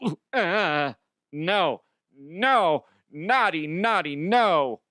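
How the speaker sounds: coy, slow tut-tut at the naughty thought; mock scolding. Teasing listeners about his underwear, a coy mock scold. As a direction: playfully scold a dirty mind.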